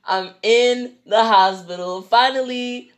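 usual speaking voice

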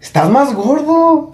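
imitación aguda y alargada de la amiga; amiga imitada. Retold or imitated. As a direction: saluda alargando las palabras, muy agudo. (amiga imitada, voz aguda y lenta: amiga imitada con voz aguda)

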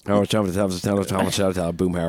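rapid mumbled cartoon drawl; Boomhauer. Riffing on being understandable, mumbled impression. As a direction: run the words together in a mumble. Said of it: "Boomhauer, that's what I was about".

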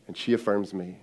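ordinary talk

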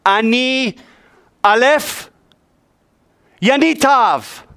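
"Ani Aleph Yanitav" said in Hebrew, high and slow; God's words voiced in Hebrew, high; Hebrew pronunciation. Shown as a demonstration. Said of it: "instead of".

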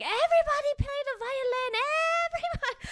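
very high, loud, sing-song auntie voice; comic impression of an old lady waving her off; mock Korean auntie. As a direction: squeal it out like a dismissive church auntie.

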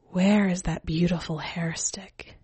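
breathy seductive whisper into his ear. Acted. Skeleton woman whispers to the old man, coaxing. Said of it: "I whispered into his ear".

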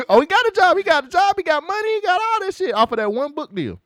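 very high-pitched, laughing protest; excited defense. Real, not acted. Laughingly defends character from co-host's jab.

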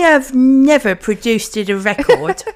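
loud, high, slow West Country parody; West Country accent. Acted. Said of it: "why I go West Country".

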